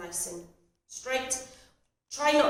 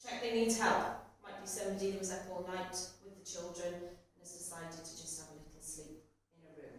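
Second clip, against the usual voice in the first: usual speaking voice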